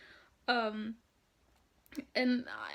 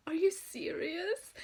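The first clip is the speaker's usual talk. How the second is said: higher, slowed, drawn-out disbelief; incredulous; 8 semitones higher than usual. Retold or imitated. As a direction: stretch it out, staring in shock. Staring at the Norwegian fan.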